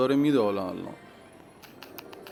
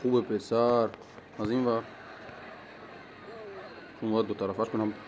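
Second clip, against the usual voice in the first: ordinary talk, nothing marked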